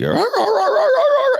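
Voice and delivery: very high, loud yelping bark; imitated dog cry. Officer shows dog's warning cry, high frantic yelping. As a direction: yelp like a dog backing away. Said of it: "he's like a cry, but they're moving back".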